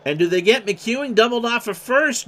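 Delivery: urgent question. Real, not acted. Live line-drive play.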